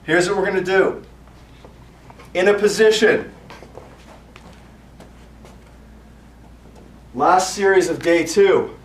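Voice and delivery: loud, driving instructor callouts; workout class calling. Acted. Fitness coach drilling the class through the last set, loud. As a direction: pump the class up, counting every rep hard.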